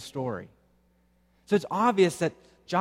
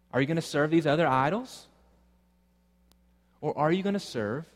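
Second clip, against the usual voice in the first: ordinary talk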